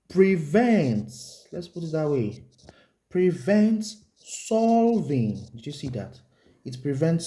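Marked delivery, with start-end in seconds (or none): 0.1-1.5 "prevents" said high and loud, second syllable stretched; stress on prevents. Real, not acted. Teacher dictating a key word to students, heavy stress on "prevents". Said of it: "emphasis on the word prevent". 3.1-5.5 "prevents solving" drawn out slowly, pitched high; emphatic key words. Real, not acted. Teacher dictating the rule to students, slow emphatic stress on "prevents solving". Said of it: "emphasis on the word prevent".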